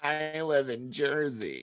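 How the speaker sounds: slow, drawn-out mimicry of a co-host; Pete. Host repeating the Jersey line, slow mock impression. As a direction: stretch it out in a put-on voice. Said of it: "It's pretty spot on".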